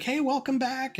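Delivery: very high, bright welcome back; thrilled to see the customer return; very excited greeting. Retold or imitated. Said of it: "And he was very excited".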